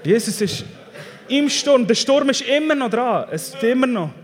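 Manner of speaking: high, loud, declaring sermon voice; full-force preaching. Real, not acted. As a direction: proclaim it boldly to the congregation. (kraftvoll gepredigt)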